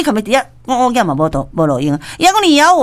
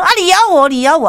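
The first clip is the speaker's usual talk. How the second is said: mock taunt; 6 semitones higher, faster than usual. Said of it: "甲你讲".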